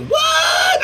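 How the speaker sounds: a sudden, very high yelled "what?"; shocked shout. Retold or imitated. Shouting back at the drive-through lady, outraged shock. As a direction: blurt it out as if you can't believe it.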